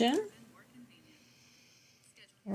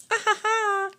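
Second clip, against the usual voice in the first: high laugh; 12 semitones higher than usual. Real, not acted. Amused after posing questions.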